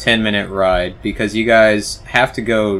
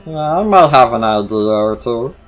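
a sly, knowing frog voice, a little louder; lightly voiced frog creature, coy and knowing; frog creature's sly reply; 3 semitones higher, 6 dB louder than usual. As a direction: play the frog as if he has a plan. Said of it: "and he's like".

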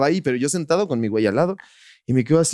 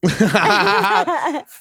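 high, loud burst of real laughter; 4 dB louder than usual. Real, not acted. Laughing at the friends' joke about her whole being. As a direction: laugh out loud at your own confession.

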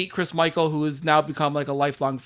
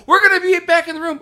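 mock wife; 11 semitones higher, 7 dB louder, faster than usual. Wife's message voiced.

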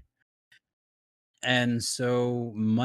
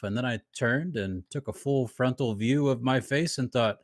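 ordinary delivery

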